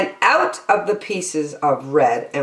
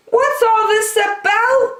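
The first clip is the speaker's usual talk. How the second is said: people shouting. A narrator's reading. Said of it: "the people came shouting".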